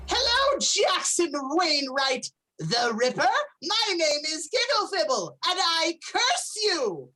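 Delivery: high-pitched cursing pixie. Acted. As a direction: lay a curse like a gleeful pixie. Pixie curses the player.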